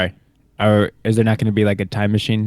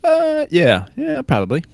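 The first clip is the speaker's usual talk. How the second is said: hesitant; 14 semitones higher than usual. Real, not acted. Hesitant reluctant agreement.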